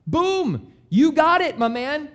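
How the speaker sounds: high, loud cheer of you got it; excited approval. Retold or imitated. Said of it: "And Jesus said".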